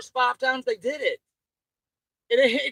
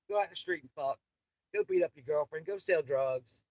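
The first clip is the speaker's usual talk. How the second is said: ordinary talk, nothing marked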